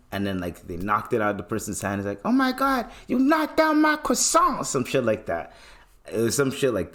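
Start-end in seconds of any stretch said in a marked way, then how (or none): 2.2-4.7 high-pitched frantic outburst; tweaking movie character. Retold or imitated. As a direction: imitate someone freaking out, voice shooting way up. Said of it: "The man was tweaking over a croissant".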